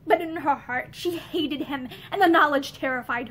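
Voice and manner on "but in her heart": plain reading voice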